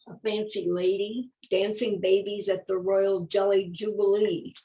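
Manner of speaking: gentle storybook reading voice; reading to children. Acted. Reading a flower picture book to a listener, gentle storybook voice. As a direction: read it like a bedtime picture book.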